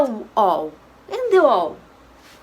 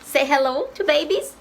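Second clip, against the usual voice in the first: ordinary talk, nothing marked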